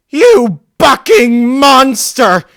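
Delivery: very high, loud, slow screaming; a grieving teen mare screaming at the killer. Acted. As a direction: scream it at him with hatred. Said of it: "she screamed at me".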